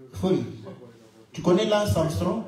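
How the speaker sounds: usual speaking voice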